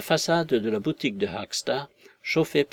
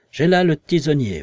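louder, raised voice on the threat; invisible man's threat; 4 semitones higher, 7 dB louder than usual. Acted. Invisible man threatens the visitors with the poker, loud and firm.

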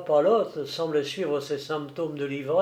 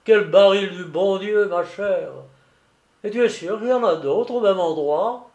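voiced tipsy keeper, a little higher; merry old sailor; 4 semitones higher, 4 dB louder than usual. A narrator's reading. Keeper questioning the girl about barrels, jovial. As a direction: open on a laugh, hearty keeper's talk.

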